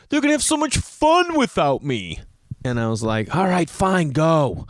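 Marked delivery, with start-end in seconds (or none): 0.1-2.2 exaggerated child's tearful whine; imitated crying son. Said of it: "So then he starts crying". 3.4-4.7 higher-pitched, acted fed-up parent; retold parental surrender; exasperated giving in.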